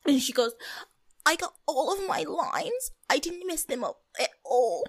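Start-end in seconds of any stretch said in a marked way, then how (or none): 1.2-4.8 lightly voiced baby talk, slow; a hint of a creepy little girl. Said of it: "creepy baby Paloma voice".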